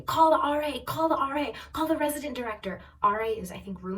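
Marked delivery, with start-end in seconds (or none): 0.0-2.8 high-pitched, insistent retold yell; mock screaming. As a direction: imitate a mother screaming orders. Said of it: "her mom is screaming".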